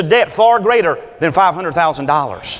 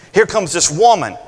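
ordinary talk, nothing marked

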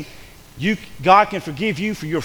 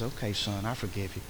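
much softer, lower gentle reply; God forgiving; 9 semitones lower, 13 dB quieter than usual. Retold or imitated. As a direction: forgive him softly, like a father. God voiced forgiving the driver.

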